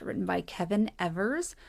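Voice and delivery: ordinary delivery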